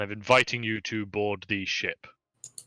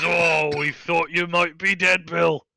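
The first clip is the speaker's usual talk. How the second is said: excited; 5 semitones higher, slower than usual. Acted. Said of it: "excitedly says".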